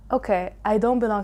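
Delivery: ordinary talk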